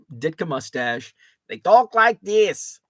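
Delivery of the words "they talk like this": put-on loud, slow, higher-pitched Chicago accent; bad Chicago accent, drawn out and loud; terrible Chicago accent. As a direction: put on a hammed-up South Side Chicago accent. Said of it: "That's a terrible Chicago accent".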